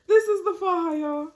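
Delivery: high laughing reveal. Real, not acted. To the viewers, laughing hard while showing the faja.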